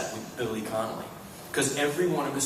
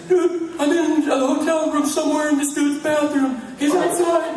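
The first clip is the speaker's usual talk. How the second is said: high, loud frantic imitation; mock panic; 8 dB louder than usual. Friend phoning from a stranger's bathroom, imitated panic. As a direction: squeal it like someone trapped.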